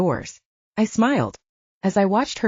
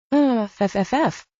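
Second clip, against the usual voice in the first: slow, muffled through a mouthful; mouth full; 6 semitones higher than usual. A narrator's reading. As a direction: talk around a big bite of bun. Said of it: "she said through a mouthful of bun".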